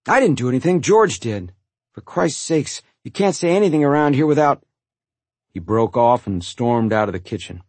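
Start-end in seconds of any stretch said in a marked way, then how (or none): 0.1-4.6 higher, louder indignant denial; exasperated self-defence; heated protest. A narrator's reading. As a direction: protest the blame hotly. Brother protests, then storms out.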